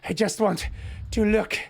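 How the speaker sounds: high, racing, breathless panic; spiralling into frantic excitement; frantic, overwhelmed. Acted. Yuri losing control.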